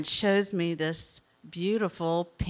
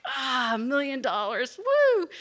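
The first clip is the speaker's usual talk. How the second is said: triumphant whoop; 7 semitones higher than usual. Retold or imitated. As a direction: celebrate like you just won a million dollars. Reaching the mountain top.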